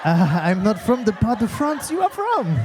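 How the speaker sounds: flustered cover-up in mock French accent; badly bluffing French accent; fake French accent. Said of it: "with a French accent".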